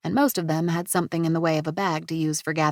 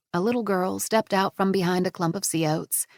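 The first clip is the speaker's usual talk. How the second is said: ordinary delivery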